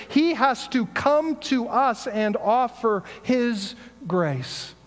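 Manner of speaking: preacher pressing the point about grace; preaching. Acted. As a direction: drive the point home to the congregation. Preaching builds after the story.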